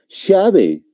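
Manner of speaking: "llave" with an Argentine "sh" at the start; Argentine pronunciation. Shown as a demonstration. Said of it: "Un argentino, ¿cómo lo va a pronunciar?".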